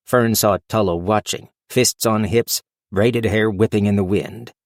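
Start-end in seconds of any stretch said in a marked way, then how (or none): none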